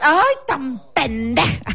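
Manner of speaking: slow, stretched grandma tune; grandma's sing-song insult; imitating grandma. Shown as a demonstration. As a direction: drag it out with grandma's lilt. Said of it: "la tonadita y todo".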